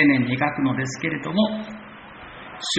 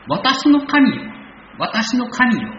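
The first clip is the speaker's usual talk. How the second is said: higher and louder, crying out to God; repeated cry; 10 semitones higher, 4 dB louder, slower than usual. Retold or imitated. Preacher echoing the psalmist's cry, high and loud. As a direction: lift the voice as the psalmist calls. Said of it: "と繰り返し叫びます".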